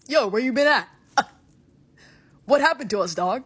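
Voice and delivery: loud, casual shout of a long-lost friend; mock hearty yell; loud bro greeting. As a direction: hail them like an old buddy across the room. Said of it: "I'll be like".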